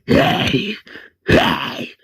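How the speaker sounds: eerie, voiceless walker rasp; walker girl. Retold or imitated. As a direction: rasp it out like an undead child. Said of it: "Walker Penny's like".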